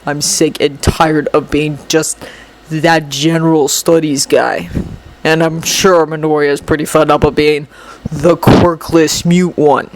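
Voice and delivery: resentful low rival; bitter boy. Acted. Shinso's voice in story.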